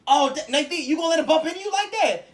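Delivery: high, loud goading call; egging on. Retold or imitated. Friend in the group egging Nathan to fight, loud goading. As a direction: stir him up to fight.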